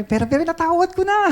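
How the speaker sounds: high, fast comic bishop voice; mock bishop. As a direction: ham up the grudging pardon. Mimics bishop's grudging forgiveness. (mataas at mabilis na panggagaya sa obispo)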